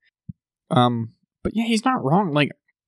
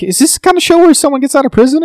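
much higher and louder, bursting with excitement; loud, high-pitched excited question about the show; excited disbelief; 13 semitones higher, 9 dB louder than usual. Retold or imitated. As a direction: blurt it out like you can't believe it. Retelling their excited guess.

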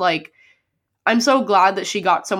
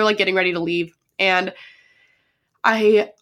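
ordinary delivery

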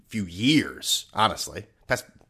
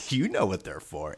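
laughing; 6 semitones lower, 6 dB quieter than usual. Real, not acted. Repeats the line through a laugh.

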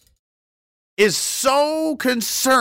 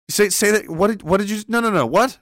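ordinary talk

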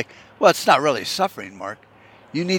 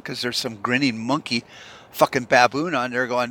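ordinary talk

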